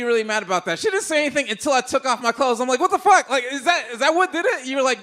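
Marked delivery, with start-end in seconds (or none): 2.8-4.7 high, loud incredulous questions; mock indignant outburst. Comic asking the woman if nudity caused it, loud outrage. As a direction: demand answers in comic outrage. Outrage she stopped after undressing.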